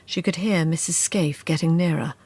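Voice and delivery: ordinary delivery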